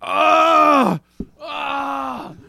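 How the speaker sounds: loud, high strained pained yell; pained cry. Retold or imitated. Retelling straining over the toilet, crying out.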